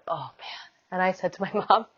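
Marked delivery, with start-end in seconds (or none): 0.1-0.6 groaned aside. Retold or imitated. Feeling another push come.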